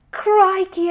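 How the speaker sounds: high, loud startled cry; surprised exclaim. A narrator's reading. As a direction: blurt it out in shock. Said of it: "exclaimed Jonathan".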